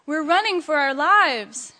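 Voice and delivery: panicked hen's shout. A narrator's reading. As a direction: call out in urgent alarm. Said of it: "Henny Penny shouted".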